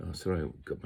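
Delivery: ordinary talk, nothing marked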